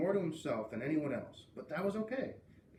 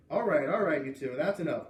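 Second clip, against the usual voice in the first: louder, calling the class to order; firm teacher call; 8 dB louder than usual. A narrator's reading. Teacher hushing two kids, loud and firm. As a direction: settle them down firmly. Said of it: "Mister Trupp said".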